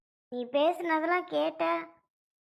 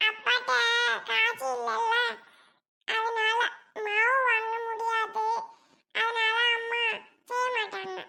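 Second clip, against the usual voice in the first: put-on high little child voice, halting phrases; plaintive small child; 7 semitones higher, slower than usual. Child explaining to uncle about no money, plaintive small child. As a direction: explain like a tiny child, piece by piece.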